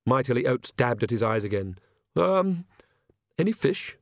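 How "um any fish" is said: timid priest voice. A narrator's reading.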